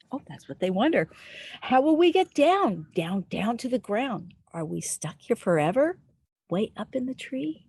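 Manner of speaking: lilting, sing-song read-aloud; reading to children. Acted. Storyteller reading a rhyming book to little listeners, sing-song. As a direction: read it like a bedtime rhyme.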